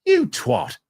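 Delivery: high mock British insult; a hammed-up British jab, way up high; bad British accent. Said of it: "It's a horrible accent".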